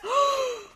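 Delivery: loud, high gasp of shock. Real, not acted. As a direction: gasp sharply in real shock. Shocked reaction to the meme.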